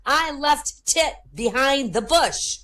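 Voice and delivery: lightly acted high, slow voice; wife spelling it out. Wife explaining her gestures to husband, high slow delivery. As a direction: spell out each word, pitched high. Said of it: "she said".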